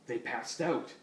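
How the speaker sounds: usual speaking voice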